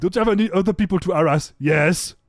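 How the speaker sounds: loud, quick mock bickering; exasperated squabble. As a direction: rattle it off, exasperated. Acted squabble with the rat.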